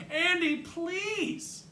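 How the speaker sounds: high, drawn-out plea; pleading call. A narrator's reading. Jen begging her brother to come out, pleading. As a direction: whine it slowly through the door. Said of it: "Jen bangs on the door again".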